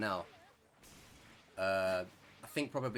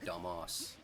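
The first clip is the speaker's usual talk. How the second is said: low, soft British "dumbass", ending in 'ahss'; British accent; 5 semitones lower, 5 dB quieter than usual. Shown as a demonstration. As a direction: say "dumbass" with a long British 'ah'. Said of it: "And we go".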